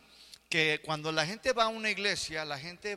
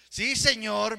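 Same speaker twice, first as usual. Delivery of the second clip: annoyed grandson; 4 semitones higher, 7 dB louder, slower than usual. Retold or imitated. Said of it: "cuando se enoja".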